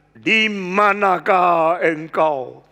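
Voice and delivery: slow, firm, loud authority; stern, serious. Retold or imitated. God demands of Adam, slow and stern. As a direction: ask with the weight of justice. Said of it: "dengan tegas ... serius".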